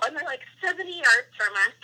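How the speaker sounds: usual speaking voice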